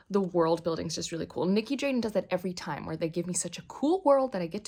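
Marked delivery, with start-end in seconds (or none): none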